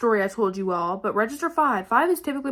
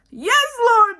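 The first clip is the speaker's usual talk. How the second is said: high, loud hammed-up cry to heaven; exaggerated pleading shout, pitched way up; desperate agreement; 12 semitones higher, 7 dB louder than usual. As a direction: cry it out like a frantic prayer. Retold desperate inner plea.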